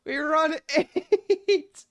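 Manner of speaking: very high, drawn-out loud scream; screeching cry of defeat; anguished wail. Real, not acted. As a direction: wail it in despair, stretching every word. Anguished outburst at losing the run.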